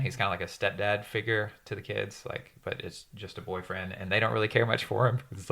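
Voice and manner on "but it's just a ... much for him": usual speaking voice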